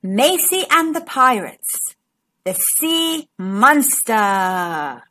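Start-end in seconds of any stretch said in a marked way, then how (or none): 2.4-5.0 deep, slow spooky title voice; low dramatic storybook title. A narrator's reading. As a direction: draw it out like a monster tale.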